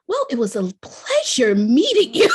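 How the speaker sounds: imitating Oprah. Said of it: "And she goes".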